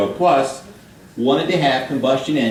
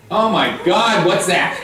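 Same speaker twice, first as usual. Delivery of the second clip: mock surprise; 5 semitones higher, slower than usual. Mock shock at slide.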